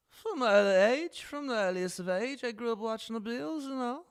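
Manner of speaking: exaggerated British accent; hammed-up British accent. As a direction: lay the British on thick, rising at the end. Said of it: "The British accent".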